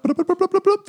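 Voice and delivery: high little button-up sound effect; quick string of squeaked bloops; rapid high blooping. Acted. Imitates buttoning up.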